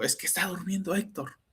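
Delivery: hushed voice. Retold or imitated. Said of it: "¿y por qué habla así?".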